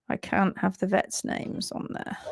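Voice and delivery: half-whispered aside to herself. Real, not acted. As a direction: drop to a whisper while fixing the slide. Aside while hiding vet names.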